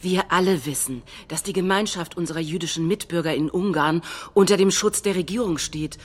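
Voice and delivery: ordinary talk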